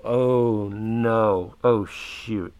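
slow, drawn-out mock dismay; mild sinking oh-no feeling; retold dread. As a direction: stretch each "oh" as trouble dawns. Retold dread in the awkward silence.

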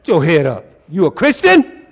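high, loud, fervent sermon; rising preacher's fire; full-force preaching. Acted. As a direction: drive it home to the congregation.

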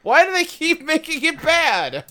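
high, loud whiny cartoon voice; high squeaky voice. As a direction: complain in a squeaky high whine. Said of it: "Oolong is like".

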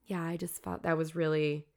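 hushed, understated serious tone; softly mimicked grave reproach; imitated seriousness. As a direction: imitate the curator's quiet disapproval. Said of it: "she's so serious".

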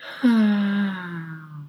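long, high, falling melancholy sigh; drawn-out unhappy sigh; deep sigh. A narrator's reading. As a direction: sigh deeply, letting it slide slowly down. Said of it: "the gnat only sighed deeply".

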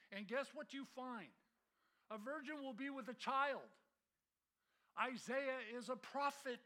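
loud, rising pulpit delivery; full-force preaching. Acted. Pastor preaching the prophecy to his church, fervent. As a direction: drive every point home to the congregation.

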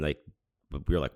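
ordinary delivery

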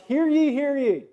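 high, loud proclamation shout; town crier. Retold or imitated.

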